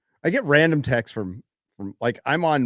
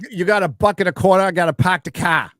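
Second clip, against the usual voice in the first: put-on Boston accent; 5 semitones higher, 4 dB louder than usual. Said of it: "do a Boston accent".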